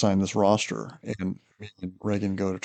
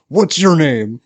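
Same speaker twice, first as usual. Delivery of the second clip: a loud, sharp higher bark of a demand; angry demand; 6 semitones higher, 10 dB louder, much slower than usual. Retold or imitated. Officer angrily demands the prankster's name loudly. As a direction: snap the question like an angry officer. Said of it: "And he goes".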